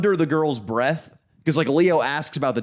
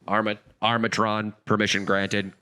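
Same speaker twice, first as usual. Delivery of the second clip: mock commander voice; 6 semitones lower than usual. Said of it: "mock command reply".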